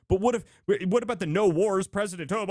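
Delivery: mock the commenter's worried whine. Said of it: "They were like".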